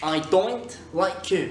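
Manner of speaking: Scottish oi vowel in don't; Scottish accent. Shown as a demonstration. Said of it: "C'est pas I don't like you".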